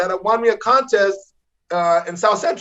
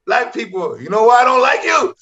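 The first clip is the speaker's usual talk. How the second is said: retold defiant joke opener, rising louder; mad, insistent; 3 semitones higher, 3 dB louder than usual. Angry comic restarting his joke over the boos, insistent. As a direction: push the line at a booing crowd. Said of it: "So I was so mad".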